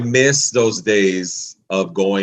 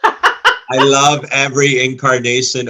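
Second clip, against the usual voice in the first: laughing; 5 semitones higher, 5 dB louder than usual. Real, not acted. Laughing fan gushing.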